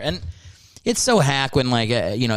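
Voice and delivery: normal talking voice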